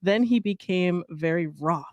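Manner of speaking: reading aloud. Acted. Friend reading the Saint Patrick legend, storybook style. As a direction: read the old legend with mock solemnity.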